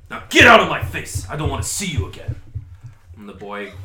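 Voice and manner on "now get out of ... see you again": higher, much louder yell; furious shout. Acted. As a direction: drive him out of your face. Dwarf yelling at boy.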